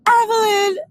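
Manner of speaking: high, loud, whining call of her name; whiny pleading friend. Retold or imitated. Mocking her friend whining Evelyn, high and loud. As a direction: whine her name like a hurt puppy. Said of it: "what I hear is this voice".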